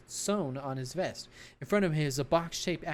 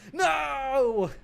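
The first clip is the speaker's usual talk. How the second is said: whiny protest; 9 semitones higher, 5 dB louder than usual. Acted. Officer protesting.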